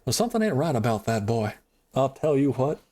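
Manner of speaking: a hint of a grumbling Texas dad; Hank Hill impression. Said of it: "I'm going to do a Hank Hill".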